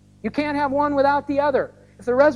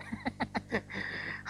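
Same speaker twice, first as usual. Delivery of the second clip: soft breathy laugh; 16 dB quieter than usual. Real, not acted. Joke about cloudy weather.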